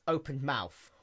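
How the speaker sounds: ordinary delivery, nothing marked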